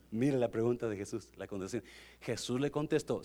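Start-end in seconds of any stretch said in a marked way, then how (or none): none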